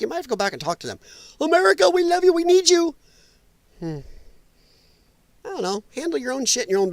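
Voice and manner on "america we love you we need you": high, loud mock begging; mock pleading. Streamer mocking allies running back to America, high, loud plea. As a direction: plead like needy allies, hammed-up.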